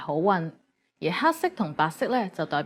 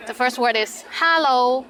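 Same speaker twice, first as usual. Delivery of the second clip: Cantonese accent; 3 semitones higher, 4 dB louder, slower than usual. Shown as a demonstration. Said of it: "but with a Cantonese accent".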